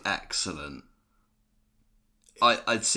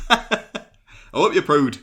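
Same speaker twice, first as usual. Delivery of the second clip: loud, higher Geordie impression; Geordie accent; 5 semitones higher, 7 dB louder than usual. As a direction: belt it out in Geordie. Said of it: "that was a lot better than mine".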